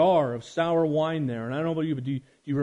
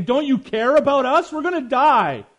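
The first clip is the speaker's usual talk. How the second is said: higher and louder, frantic plea; panicked cry; 10 semitones higher, 9 dB louder than usual. Acted. Disciples panicking in the storm, shouting higher and louder. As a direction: cry out as if the boat is sinking. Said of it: "the disciples were freaking out".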